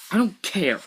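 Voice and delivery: high mock-shrill retort. Boy retorting to his brother, lightly shrill. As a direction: snap it back high. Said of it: "I replied shrilly".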